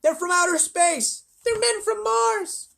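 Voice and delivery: high and loud, very slow; excited shout. Acted. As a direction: bellow it in wild excitement. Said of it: "they shouted".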